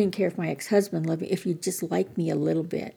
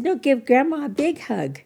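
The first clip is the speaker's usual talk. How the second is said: higher, louder grandma coaxing; playful coaxing; 8 semitones higher, 4 dB louder than usual. Retold or imitated. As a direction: cheerfully insist like a grandma. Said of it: "And I said".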